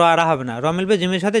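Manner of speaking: normal talking voice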